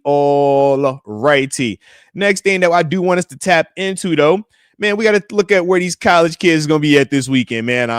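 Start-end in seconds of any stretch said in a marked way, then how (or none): 0.0-1.8 slow stretched all righty. Real, not acted. Streamer moving to next topic, slow drawn-out all righty. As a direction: drag out all righty very slowly, falling.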